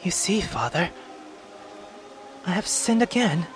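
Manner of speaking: put-on a girl admitting her sin; hesitant young girl confessing to Father.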